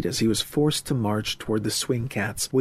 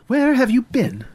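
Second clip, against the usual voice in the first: high, louder demand from an angry mother; scolding mother; 12 semitones higher, 5 dB louder than usual. Acted. Mother waiting up for her son, scolding. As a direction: confront him as a cross mother, pitched high.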